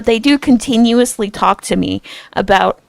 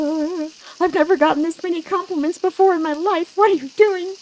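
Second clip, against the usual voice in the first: much higher, rising fluster; flustered squeak; 9 semitones higher than usual. Retold or imitated. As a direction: squeak it out, embarrassed. Retold flustered reaction to compliments.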